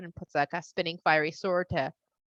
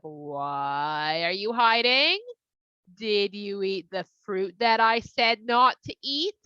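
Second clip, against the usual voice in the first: slow stern God; much slower than usual. Acted. God questions hiding humans.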